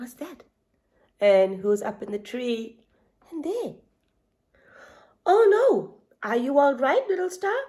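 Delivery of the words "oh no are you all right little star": a lightly voiced zebra, higher and louder at the start; a hint of an anxious zebra calling out; Zebra, worried. A narrator's reading. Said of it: "asked Zebra".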